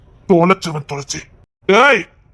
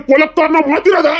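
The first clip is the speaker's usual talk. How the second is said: angry warning; 7 semitones higher than usual. Acted. Husband threatening the father-in-law in anger. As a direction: warn him off hard.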